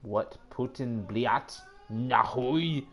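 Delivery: "What Putin bliat nahui" drawn out slow in a fake Russian accent; mock Russian phrase. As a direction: sound it out slow like reading broken Russian. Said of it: "Do I can speak Russian? Let's see".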